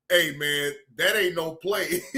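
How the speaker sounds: lightly voiced low Ears impression; low mock voice. Quoting Ears to Lance, mock low voice. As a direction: drop low to imitate him. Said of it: "he came over here and said".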